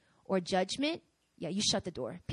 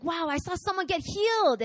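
high-pitched amazed testimony; wide-eyed, breathless-style wonder; acted excitement; 9 semitones higher, 3 dB louder than usual. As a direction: share astonishing news, voice lifted. Acting excited testimony about healing.

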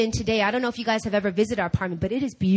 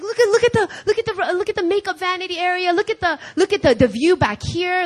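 high, tumbling gush with restarts; breathless enthusiasm; 6 semitones higher, 4 dB louder than usual. Retold or imitated. Husband showing wife every feature, thrilled. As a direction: imitate someone too thrilled to finish.

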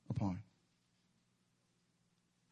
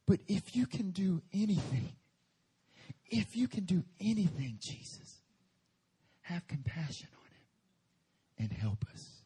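breaking, pleading father, fading to a breathy plea; desperate plea; 2 semitones higher than usual. Shown as a demonstration. Father begging Jesus for his son, desperate and fading. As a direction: beg for help as a helpless father. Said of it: "Imagine his emotion".

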